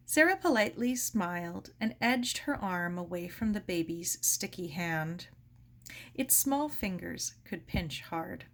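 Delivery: ordinary delivery, nothing marked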